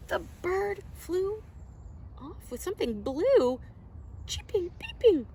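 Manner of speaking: high excited storytime voice. Acted. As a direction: share the wonder with the kids. Baby bird appears.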